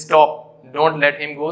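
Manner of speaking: "stop" set apart, then a slow "don't let him go"; slow explaining. Shown as a demonstration. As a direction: read it slowly for students. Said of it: "तो यहाँ पे इसे". (धीरे-धीरे समझाते हुए)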